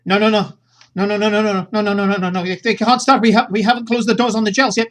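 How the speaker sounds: loud, high, rapid panic; frantic no. Acted. She panics at the crew before the drums start, loud and high. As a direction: stop them before the machine starts. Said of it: "and she goes".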